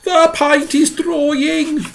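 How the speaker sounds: high, slow moaning lament. A narrator's reading. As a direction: moan in misery. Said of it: "he moaned".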